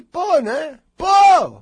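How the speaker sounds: loud, high indignant cry of pô; indignant outburst. Retold or imitated. Imitating an indignant person who feels owed help, loud and high. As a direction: play the offended person shouting pô.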